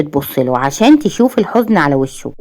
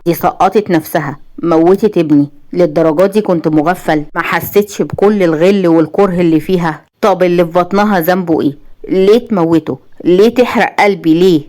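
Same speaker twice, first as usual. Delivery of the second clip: usual speaking voice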